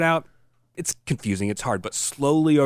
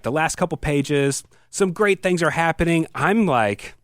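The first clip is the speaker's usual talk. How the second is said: ordinary talk, nothing marked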